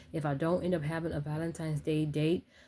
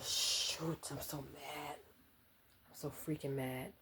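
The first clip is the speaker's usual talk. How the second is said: muttered under her breath, much softer; quiet grumble; 13 dB quieter, slower than usual. Real, not acted. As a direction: grumble it to yourself, annoyed but hushed.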